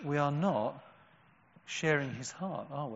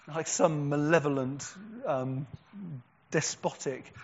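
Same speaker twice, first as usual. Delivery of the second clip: ordinary talk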